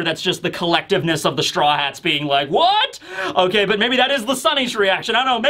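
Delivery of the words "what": high, rising baffled "what?"; sharply raised, questioning yelp; collective confusion. Acted. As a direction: voice a whole crew's bafflement in one jump of pitch. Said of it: "just has the question mark over it".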